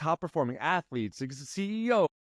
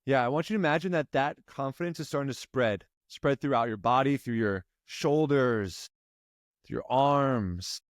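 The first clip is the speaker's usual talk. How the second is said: guided meditation. Acted. Coach guiding client through breathing, calm meditation voice. Visualization continues.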